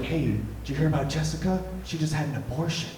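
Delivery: soft, secretive near-whisper; hushed gossip. Acted. Passing on gossip about Jessica's abortion, in a hush. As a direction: hush it like scandalous news. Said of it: "it would sort of be in a hush".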